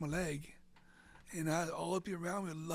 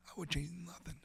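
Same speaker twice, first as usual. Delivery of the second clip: whispered; 6 dB quieter, faster than usual. Real, not acted. Sincere reflective moment.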